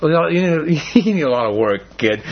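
laughing through a gentle tease; laughing fondly. Retold or imitated. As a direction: chuckle it out like a fond father. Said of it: "it was almost as though God just said".

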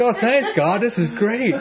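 high, delighted voice; happy Jonah. Acted. Jonah thanking God for the shade, delighted. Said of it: "He's really happy".

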